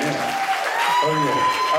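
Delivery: high, slow hype call; drawn-out party hype to wedding guests; hyping the crowd. Real, not acted. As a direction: stretch it out to pump up the room.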